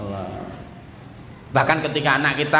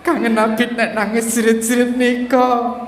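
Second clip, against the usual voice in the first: higher, pleading parent voice; mock tearful parent; 5 semitones higher than usual. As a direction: play the grieving father begging.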